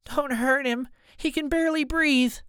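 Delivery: high, desperate plea; frantic, high-pitched woman's plea; screaming mother. A narrator's reading. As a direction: cry out high, begging them to stop. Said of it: "Mom screams".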